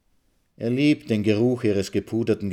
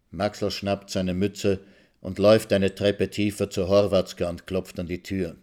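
plain reading voice

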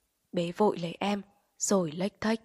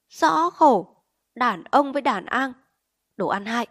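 higher, louder exasperated scolding; scolding demand; 4 semitones higher, 6 dB louder than usual. A narrator's reading. Wife yells at husband to hand over the money, exasperated. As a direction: demand the money, then explode. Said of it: "Chị quát anh Tèo".

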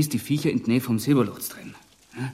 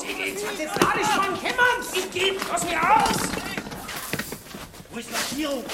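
frightened panic. Acted. Fleeing in dark tunnel.

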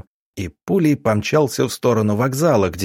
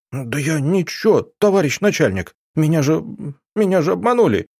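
pleading; 6 semitones higher than usual. Acted. Terrified suspect pleads with investigator.

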